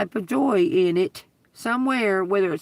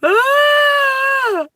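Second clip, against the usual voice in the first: high piercing fake shriek, much higher and louder; a hammed-up seizure cry; mock scream; 10 dB louder than usual. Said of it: "sit there and go".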